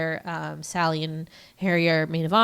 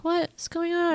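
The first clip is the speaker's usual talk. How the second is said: puzzled quote; 11 semitones higher than usual. Retold or imitated. Friend recaps her reaction to him leaving, puzzled and high. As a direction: mimic her baffled question, pitched up. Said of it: "she's like".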